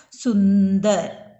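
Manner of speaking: सुंदर drawn out slowly, the n clearly sounded; slow, stressed nasal. Shown as a demonstration. Teacher modelling the word slowly for the class. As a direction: stretch सुंदर slowly and lean on the n. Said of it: "समझ में आ गया ना?".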